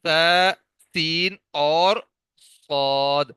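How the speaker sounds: تا, سین, صاد each drawn out and clearly sounded; letter demo. Shown as a demonstration. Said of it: "اس کے بعد تین حروف ہیں".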